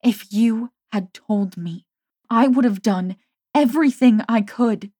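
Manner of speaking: pleading. A narrator's reading. Pleading with her friend.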